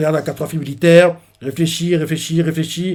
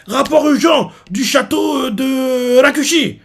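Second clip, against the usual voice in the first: urgent report; 9 semitones higher, slower than usual. Retold or imitated. Another messenger arrives.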